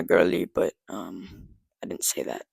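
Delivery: a hushed confession under the breath; whispered admission; whispered aside. Real, not acted. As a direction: drop to a whisper like a secret. Confiding aside to viewers.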